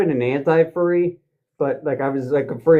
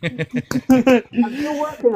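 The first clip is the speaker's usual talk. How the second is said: laughing through the words, slow; 8 semitones higher, much slower than usual. Real, not acted. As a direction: chuckle the line out. Laughing after own howl.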